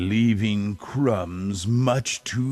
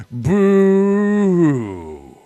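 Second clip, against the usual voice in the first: mock Whos' boo; 7 semitones higher, 6 dB louder than usual. A narrator's reading. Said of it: "will all cry".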